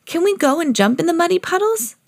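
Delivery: put-on high-pitched eager child; Peppa. A narrator's reading. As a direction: ask like a hopeful little pig. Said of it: "asked Peppa".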